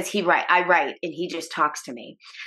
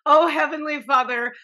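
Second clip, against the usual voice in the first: high, laughing plea to Heavenly Father; laughing prayer; 6 semitones higher than usual. Retold or imitated. Mom prays for her mother while laughing, high-pitched. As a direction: pray through a nervous laugh. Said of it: "I was like".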